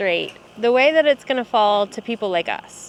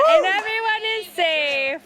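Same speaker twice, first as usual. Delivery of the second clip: relieved excitement; 10 semitones higher than usual. Real, not acted. Relief after tree falls.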